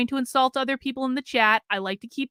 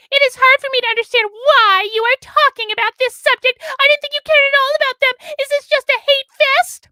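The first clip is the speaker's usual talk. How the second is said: high, loud whiny mock complainer; 14 semitones higher, 9 dB louder than usual. Streamer mocking a viewer's complaint in chat, high loud whine. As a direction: read it as an overwrought, squealing complainer.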